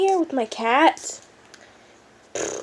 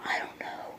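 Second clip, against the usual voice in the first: breathless whisper, afraid of the noise; scared whisper; 16 dB quieter than usual. Real, not acted. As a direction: whisper it so no one outside hears. Hears someone outside, scared.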